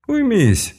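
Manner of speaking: high sharp mage command; commanding shout. Acted. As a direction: snap a furious order. Mage restrains struggling captive.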